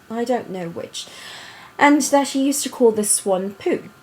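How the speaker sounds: put-on storybook reading in an affected British accent. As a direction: read it aloud like a British storyteller. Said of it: "do it in that accent".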